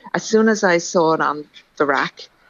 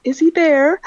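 put-on nervous young girl; 11 semitones higher, 4 dB louder, slower than usual. Retold nervous phone call to boy.

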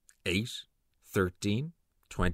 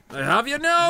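triumphant; 14 semitones higher, 7 dB louder than usual. Acted. Triumphant capture.

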